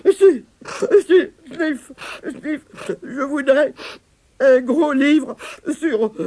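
high, slow, tearful whimpering with sniffs; sobbing child. Acted. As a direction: sniffle through the words like a crying little creature. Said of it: "Comme Denis recommençait à pleurer". (voix aiguë et larmoyante, entre deux sanglots)

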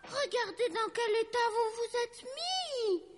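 very high, wailed scolding; high-pitched outraged dragon aunt; scolding yell. A narrator's reading. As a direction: cry out high at naughty toys, stretching the end. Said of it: "hurla Lucie".

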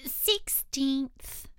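drowsy whispered reply; sleepy snoring dormouse. Acted. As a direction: murmur it half asleep.

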